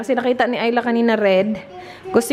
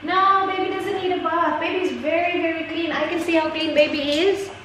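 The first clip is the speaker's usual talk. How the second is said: playful mother voice; 5 semitones higher than usual. Pretend. Playing along with daughter's doll.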